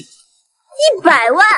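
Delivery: loud, high, drawn-out outcry; stunned, incredulous exclamation; shocked disbelief. Acted. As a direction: blurt the figure back, stretched out and rising. Shocked at the huge penalty fee.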